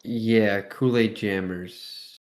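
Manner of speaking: usual speaking voice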